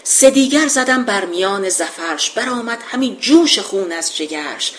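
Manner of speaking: measured epic verse reading; poem recitation. Acted. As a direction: declaim the couplet like an old epic.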